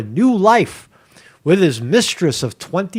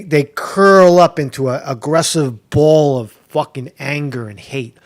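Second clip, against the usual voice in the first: ordinary delivery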